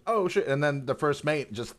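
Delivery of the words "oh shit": mock shocked. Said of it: "it's just like".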